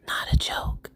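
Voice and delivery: lightly done politician impression, in a loud whisper; hushed but forceful mock-presidential emphasis; Biden. Gardener vouching for her appetizers, Biden voice. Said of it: "In my Biden voice".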